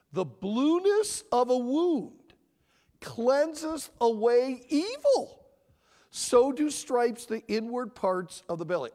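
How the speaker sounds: loud, high, slow proclamation; forceful scripture reading. Acted. Preacher reading Proverbs to listeners, loud and emphatic. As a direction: proclaim the verse with weight on each phrase.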